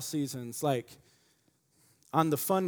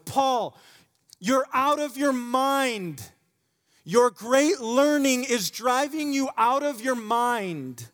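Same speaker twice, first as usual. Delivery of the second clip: higher, slow, ringing accusation; loud Festus; 10 semitones higher, 7 dB louder, slower than usual. Acted. Festus shouting at Paul before King Agrippa, loud and slow. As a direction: call Paul mad across the courtroom. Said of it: "Festus said with a loud voice".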